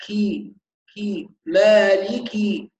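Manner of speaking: كي with a breathy, voiceless kaf; whispered kaf. Shown as a demonstration. Teacher modelling the voiceless kaf slowly. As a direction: release كي with clear breath. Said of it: "لازم نوضح همس".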